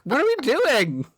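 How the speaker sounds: high-pitched, incredulous query; baffled mock question. Viewer reacting to the odd voice, high baffled question. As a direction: ask it in high-pitched disbelief.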